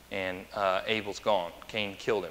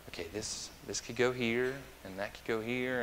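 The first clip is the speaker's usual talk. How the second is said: softly thinking aloud; imagined house viewer, quietly working it out; musing to oneself; 9 dB quieter than usual. Acted. House buyer picturing where furniture could go, quiet musing.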